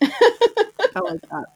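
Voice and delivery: slow, high teasing drawl; high, slow playful approval; amused. Real, not acted. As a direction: savour it, high and slow with a grin. Teasing reply to odd summary line.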